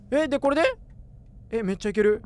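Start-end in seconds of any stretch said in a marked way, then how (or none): none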